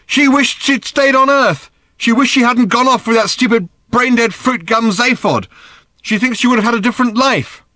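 put-on high, loud, bitter teenage rant; angry rant. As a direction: spit out the grievance. Daughter ranting about her mother.

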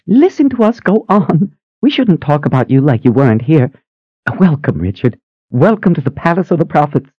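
put-on high, quick, eager old sister; bubbly high-pitched sister asking. A narrator's reading. Phoebe welcomes Richard.

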